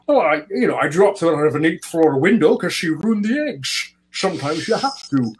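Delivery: hammed-up Sean Connery voice; playful Sean Connery impression; Sean Connery accent. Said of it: "doing a Sean Connery in, uh, accent".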